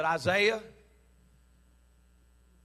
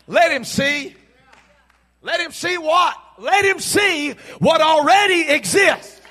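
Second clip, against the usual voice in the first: shouted sermon climbing higher and louder; full-force preaching, building; 11 semitones higher, 15 dB louder than usual. Real, not acted. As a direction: build to a high, roaring preacher's climax.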